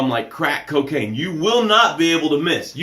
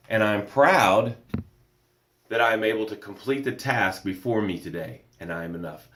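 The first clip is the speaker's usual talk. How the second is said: softer, lower comment reading; 4 semitones lower, 3 dB quieter than usual. Acted. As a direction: read the viewer's words gently. Reads viewer comment aloud.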